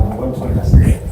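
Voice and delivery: hushed, intense and brave; dramatic investigator. Pretend. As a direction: announce it like a horror hero. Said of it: "how visceral I went".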